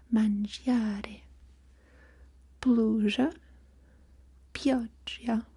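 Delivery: ordinary talk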